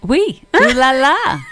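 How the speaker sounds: high, loud hammed-up French "ooh la la"; mock French. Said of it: "Do you speak any other languages?".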